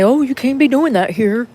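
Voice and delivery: imitating police telling her to leave; mock cop. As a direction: play the cop ordering her off. Imitating cops shooing her.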